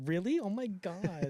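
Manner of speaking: high mock girlish gushing; smitten fangirl.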